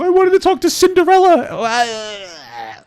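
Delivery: slow, high spoiled complaint; lightly mocking entitled guest; whiny mock customer. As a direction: mimic a pouting rich guest, drawn out. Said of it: "What's your biggest issue".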